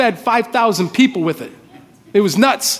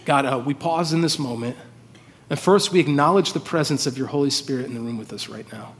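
quiet, lowered praying voice; unhurried prayer, growing softer towards the close; congregational prayer; 4 semitones lower, 4 dB quieter than usual. Real, not acted. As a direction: pray gently on behalf of the room.

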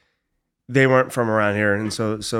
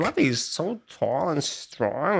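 softer, drawn-out mock swoon; imitated swooning girlfriend; 4 semitones higher, 7 dB quieter than usual. Girlfriend admiring Wahlberg, mock dreamy gush. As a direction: sigh over him slowly.

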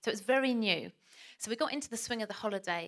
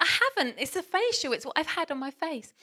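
high, insistent protest; acted-out indignant denial; mock defensive; 6 semitones higher, 5 dB louder than usual. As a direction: protest your innocence, raised voice. Acted defensive reply to Dan.

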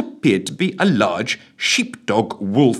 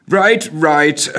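weary PM; 7 semitones higher, 5 dB louder than usual. A narrator's reading. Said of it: "sighs offered the P M".

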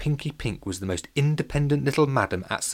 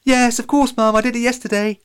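high, loud innocent fib; a boy fibbing brightly to his mum; 13 semitones higher, 9 dB louder than usual. A narrator's reading. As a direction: sell the lie too eagerly.

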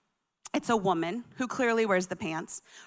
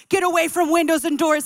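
loud, raised warning voice; urgent alert; 5 semitones higher, 7 dB louder than usual. Retold or imitated. Phone alert warning people in a storm, urgent. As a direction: imitate an emergency alert.

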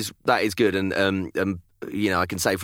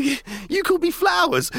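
amused. Real, not acted. Retelling the funny moment.

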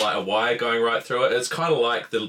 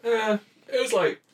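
laughing; 8 semitones higher than usual. Real, not acted. Laughing, hesitant.

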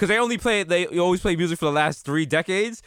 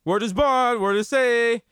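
higher-pitched parody introduction; slangy announcer; 6 semitones higher than usual. Acted. Mayor hands over at press conference, mock announcer. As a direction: introduce a dignitary in mock hip-hop style.

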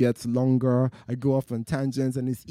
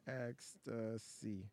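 Ecstasy said slowly and far softer, drawn out; breathy, hushed Ecstasy; 2 semitones lower, 21 dB quieter, much slower than usual. Shown as a demonstration. Poet to audience, slow soft Ecstasy. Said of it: "another word".

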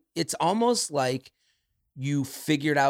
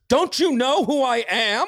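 raised pitch, self-important challenge; put-on seriousness, pitched up; mock self-serious indignation; 7 semitones higher, 3 dB louder than usual. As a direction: act out a celebrity demanding recognition. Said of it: "seriousness would you going".

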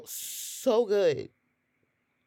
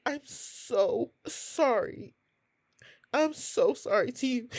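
high, slow, tearful apology; emotional apology; 6 semitones higher, slower than usual. Real, not acted. Apologizing to her younger self, choked up with emotion. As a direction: draw out the sorry through real tears. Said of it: "I'm getting emotional".

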